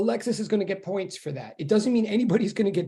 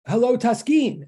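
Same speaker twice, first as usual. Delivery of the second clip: higher-pitched roll of hellos, each falling away; calling out greetings; 4 semitones higher, 5 dB louder than usual. Real, not acted. Teacher greeting students as they come into online class, calling out. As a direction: greet each one by name in a raised, lilting call.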